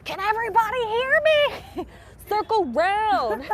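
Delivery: gather the group around like a guide; tour guide voice. Shown as a demonstration. Joins the tour guide bit.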